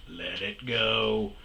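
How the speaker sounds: slow, drawn-out let it go swelling loud then falling; slow self-soothing mantra, one loud burst; calming mantra. Retold or imitated. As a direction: chant it to yourself to stay calm. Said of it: "The whole time I'm like".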